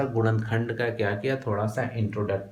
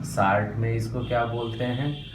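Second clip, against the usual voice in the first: normal talking voice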